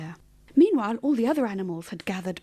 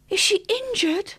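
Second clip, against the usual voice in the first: high, slow, fearful question; anxious horror, pitched high; horrified question; 11 semitones higher than usual. A narrator's reading. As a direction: ask it dreading the answer. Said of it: "Goldie asked in horror".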